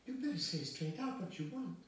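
high, loud, distressed plea; cry of distress. Acted. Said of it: "Sonia cried in distress".

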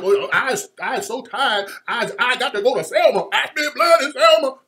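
loud, mocking Southern orator impression; hammed-up, forceful mock old Southern speech; John Lewis. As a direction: belt out a caricatured Southern preacher-style lament. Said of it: "got her talking like John Lewis".

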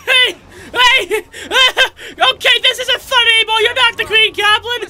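half-laughing, half-yelling at the game; frantic shouting. Real, not acted. Frantic while fighting bosses.

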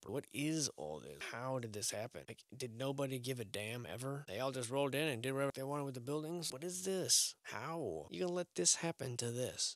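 ordinary delivery

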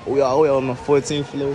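ordinary talk, nothing marked

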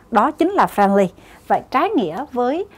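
usual speaking voice

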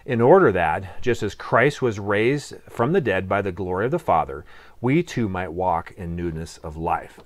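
low, measured Bible reading; scripture reading. Acted. Reads scripture aloud.

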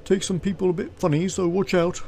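high-pitched character voice; guard's warning. Acted.

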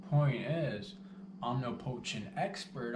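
ordinary talk, nothing marked

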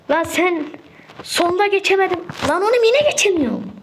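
rising exasperated shout at the game; frustrated yell. Real, not acted. Gamer yells at the level he keeps failing, frustrated. Failing the same jump again.

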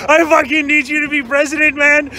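ordinary talk

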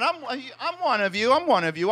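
ordinary talk, nothing marked

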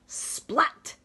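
high loud splat. Acted. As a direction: pop the splat like raindrops. Rain sound.

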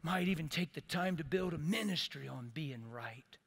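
ordinary delivery

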